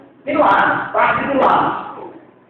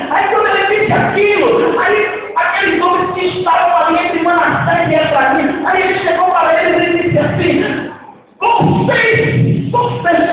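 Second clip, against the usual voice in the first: preaching at full force; 6 semitones higher than usual. Acted. Pastor to congregation, fervent raised preaching. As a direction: proclaim it with God's authority.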